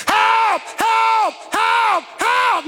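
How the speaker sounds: high shouted help, help; mock cries for help. Preacher acting out trapped people crying out, high shouted cries. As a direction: call out like trapped people begging. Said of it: "Some people were crying".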